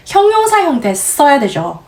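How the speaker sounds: ordinary delivery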